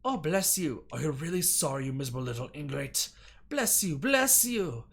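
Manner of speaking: a hint of a haughty scold; mock scolding. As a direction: sneer it out like a stern mistress. Voicing the scolding woman.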